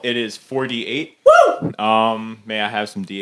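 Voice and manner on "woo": very high loud woo; cheering. Real, not acted. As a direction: whoop with joy. Cheer at rolling dice.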